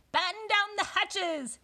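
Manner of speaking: slow, high, loud command; shouting. A narrator's reading. Captain orders crew in storm, high shout. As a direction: shout the order across the deck.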